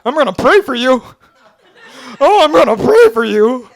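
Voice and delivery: mock threat. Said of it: "I'm like".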